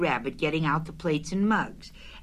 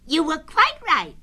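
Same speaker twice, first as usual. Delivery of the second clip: high, louder character voice; Rabbit's voice; 13 semitones higher, 6 dB louder than usual. A narrator's reading. Rabbit looking Pooh over, high character voice. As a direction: speak up high as a fussy host.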